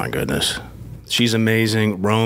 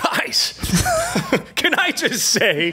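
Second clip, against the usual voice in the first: high, drawn-out, laughing send-up of himself; hammed-up starstruck squeal; mock gushing audition entrance; 12 semitones higher, slower than usual. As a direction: play an overeager actor greeting the room. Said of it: "if you were like that".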